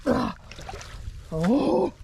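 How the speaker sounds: cold water shock; shocked yelp. Real, not acted.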